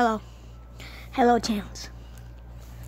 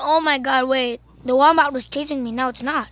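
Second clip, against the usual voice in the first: ordinary delivery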